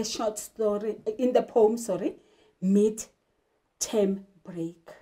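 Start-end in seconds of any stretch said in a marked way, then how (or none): none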